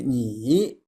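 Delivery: 你 dropping low, then swinging up; pinyin-style third tone. Shown as a demonstration. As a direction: stretch 你 into a dip and rise. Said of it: "But pinyin teaching that way".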